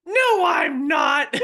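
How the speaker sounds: high, loud, drawn-out mock wail; hammed-up squawk of denial, stretched out; mom's indignant protest. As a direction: shout it like an outraged mom. Mom's indignant comeback to joke.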